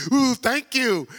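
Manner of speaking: hammed-up gratitude, squealed high; mock gushing thanks. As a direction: gush like you've just been flattered. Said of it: "you like".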